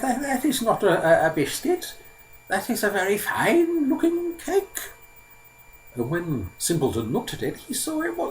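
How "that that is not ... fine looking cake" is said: put-on very high, halting old man's voice; old man, marvelling. Old man sees Simpleton's biscuit, high and amazed.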